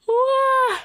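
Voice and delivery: sudden high yelp of shock; a high startled wah; startled cry. Real, not acted. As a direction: yelp as if caught off guard. Startled by what she found.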